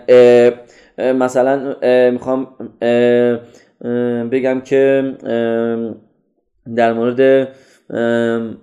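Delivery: halting speaker full of drawn-out filler sounds; تپق با «اه». Retold or imitated. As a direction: imitate a hesitant speaker stalling on fillers. (با مکث و «اه»های کشیده)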